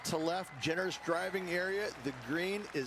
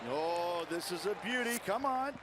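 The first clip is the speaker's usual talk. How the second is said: excited. Acted. Commentator urging a good shot.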